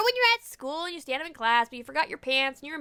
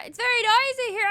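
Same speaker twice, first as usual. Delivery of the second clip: high shout over a racket; shouting over noise; 9 semitones higher than usual. Shown as a demonstration. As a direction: yell over the din.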